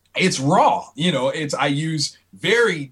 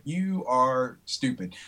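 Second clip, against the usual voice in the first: slow, softer deadpan verdict; retold dry dismissal; deadpan put-down; 2 semitones lower, 6 dB quieter, slower than usual. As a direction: drag it out, unimpressed. Artist's retold verdict on idea.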